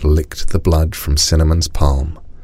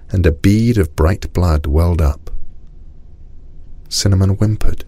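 ordinary delivery

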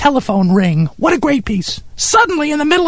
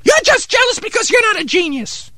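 indignant retort; 8 semitones higher than usual. Acted. Stung retort to Steve's mockery.

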